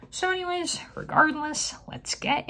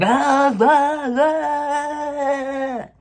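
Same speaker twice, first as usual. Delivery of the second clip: high, loud hammed-up Russian villain yells; mock rhino grunts; 13 semitones higher, 9 dB louder, much slower than usual. Reviewer mocking the movie's Russian rhino villain, with loud high hammed-up yells. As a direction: ham up the villain's battle cries. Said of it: "a Russian guy, going".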